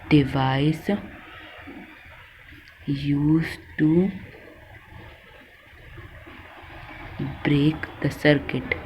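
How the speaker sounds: drawn-out words with long pauses; slow, spaced-out note-giving; slow dictation. Real, not acted. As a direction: dictate piece by piece as you write. Dictating while writing it out.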